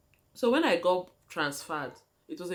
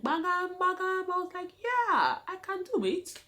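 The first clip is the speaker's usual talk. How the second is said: high bright burst of decision; excited, much higher; excited resolve; 13 semitones higher than usual. Retold or imitated. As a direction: say it as the idea clicks. Sudden idea in her head.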